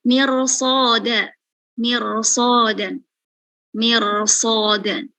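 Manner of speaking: "mirsodan" drawn out slowly, thick r and s, lips not pushed forward; slow thick pronunciation. Shown as a demonstration. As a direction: stretch "mirsodan" with a heavy back-tongue r. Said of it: "jangan maju bibirnya". ("mirsodan" pelan, tebal, bibir jangan maju)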